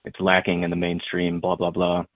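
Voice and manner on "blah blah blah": ordinary talk, nothing marked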